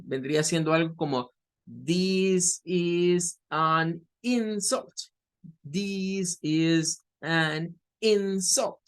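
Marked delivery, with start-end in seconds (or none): 1.9-8.8 word-by-word, very slow, with IN stressed in "insult"; measured teacher demo, "insult" as IN-sult. Shown as a demonstration. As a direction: separate each word and land hard on the IN of "insult". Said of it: "Marcando con más fuerza el in".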